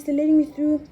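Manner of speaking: high, loud, stress on "me"; gushing, pushing "me"; excited. Real, not acted. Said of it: "They're so nice".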